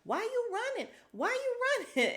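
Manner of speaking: very high squeaky mock of Fatum taunting; imitating Fatum. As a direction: squeal it up top like a cartoon heckler. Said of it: "she's like".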